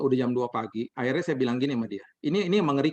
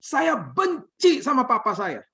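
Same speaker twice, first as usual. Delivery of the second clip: imitating a high, slow cry of hate; acted anguished high-pitched outburst; tearful shouting; 10 semitones higher, 4 dB louder, slower than usual. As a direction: wail it high, stretching the words. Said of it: "dengan tangisan dia mulai berteriak".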